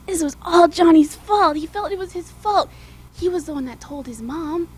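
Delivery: ordinary delivery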